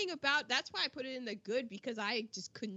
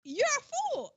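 mock scolding; 13 semitones higher, 4 dB louder than usual. Real, not acted. Scolding character mockingly.

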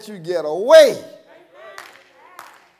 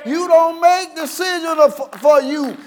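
raised, insistent preacher's delivery; full-force preaching; 6 semitones higher, 4 dB louder than usual. Real, not acted. Preacher tells believers God decides, forceful preaching. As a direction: press each phrase on the congregation.

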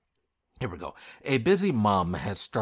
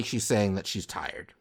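ordinary talk, nothing marked

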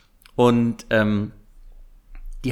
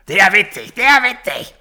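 mocking imitation; 7 semitones higher, 7 dB louder than usual. Mocking the angry man.

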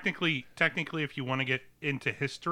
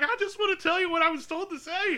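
put-on much higher, earnest little boy; high kid voice. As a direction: plead like a nervous child. Kid voiced pleading to president.

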